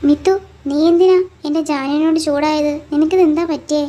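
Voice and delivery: loud, forceful reproach; angry scolding. Acted. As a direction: demand an answer from the child's mother. Grandmother scolds mother. (ഉറക്കെ ശകാരിച്ച്)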